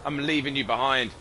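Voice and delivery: ordinary talk, nothing marked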